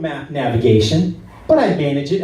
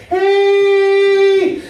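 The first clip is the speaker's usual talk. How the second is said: very high, drawn-out holler; sustained mock road yell; long "hey" yell; 4 dB louder than usual. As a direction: hold one high warning shout. One long warning yell at driver.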